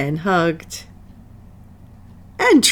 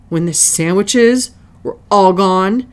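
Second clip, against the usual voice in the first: ordinary delivery, nothing marked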